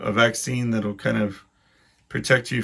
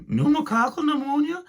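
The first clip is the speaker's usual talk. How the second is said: put-on much higher, quavering old person asking "Pneumococcal pneumonia?"; panicked old person. Old people watching the ad, panicking at "Pneumococcal pneumonia?". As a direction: squeak out "Pneumococcal pneumonia?" like a frightened old man. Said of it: "I could picture all these old people going".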